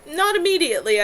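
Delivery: calling back. A narrator's reading. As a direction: answer loudly across the house.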